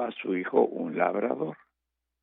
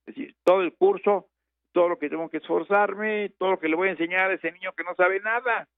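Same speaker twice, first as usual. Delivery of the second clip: higher, emphatic schoolmaster; teacher's demand; 7 semitones higher than usual. Retold or imitated. Teacher telling the farmer his price, high and emphatic. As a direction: lay out the fee like a stern teacher.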